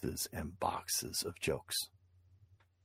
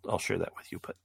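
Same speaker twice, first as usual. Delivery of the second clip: usual speaking voice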